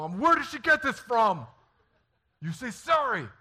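very high, loud shouting; hammed-up frantic shout; retold exasperated yelling. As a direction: holler like a parent at wits' end. Retold shouting at defiant daughter.

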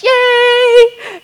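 high, sung out cheer of joy; hammed-up churchy celebration; mock cheer. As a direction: sing out a happy church yay. Mock joyful cheer after church.

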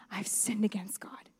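a low broken whisper; confessing whisper. Retold or imitated. King confessing to the prophet, in a low whisper. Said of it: "David says to Nathan".

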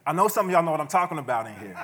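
laughing shout to the congregation; laughing call out. Real, not acted.

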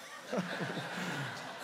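soft real laugh at own joke; amused laugh. Real, not acted. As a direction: laugh quietly at your own punchline. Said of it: "that's a good one".

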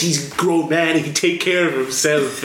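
cheesy wrestler impression. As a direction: ham it up as an indignant wrestler. Acting out Joey's cheesy line.

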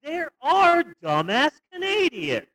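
high, drawn-out sarcastic shout of discovery; hammed-up outrage at dumb neighbours, stretched slowly; mock exasperated shout. Mocking Americans who just watched the movie, in a high, slow sarcastic shout. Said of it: "I'll see a movie like that and I'll go".

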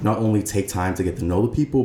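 ordinary delivery

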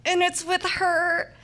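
disbelief. Retold or imitated. Shocked aside about a churchgoing girl.

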